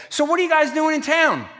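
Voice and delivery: loud, high, hearty question; booming husband. Retold or imitated. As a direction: imitate an oblivious husband calling out brightly. Husband voiced, booming friendly question.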